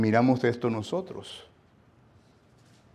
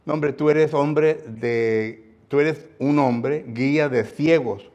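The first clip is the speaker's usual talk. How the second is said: louder, very slow mock accusation; preacher pretending to insult another pastor; 3 semitones higher, 5 dB louder, much slower than usual. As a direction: spell out the insult slowly. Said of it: "No, no le va a gustar".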